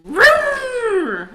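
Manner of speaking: loud, high-pitched engine roar; imitated engine revving. Husband flooring it in the snow, imitated engine revving. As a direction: rev like a car in first gear. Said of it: "puts it in first gear and he goes".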